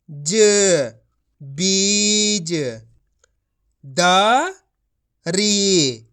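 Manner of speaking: slow, deliberate syllables, then the blended word; phonics drill. Shown as a demonstration. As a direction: sound out each syllable, then join them.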